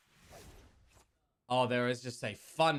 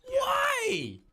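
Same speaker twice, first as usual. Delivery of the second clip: high-pitched shouted why; shocked shout; 8 dB louder than usual. Real, not acted. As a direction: yell it in disbelief. Caster reacts to skinned player.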